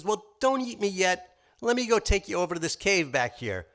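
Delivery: pleading rabbit. Acted. Rabbit coaxing the wolf not to eat him yet. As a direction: coax the wolf toward the cave.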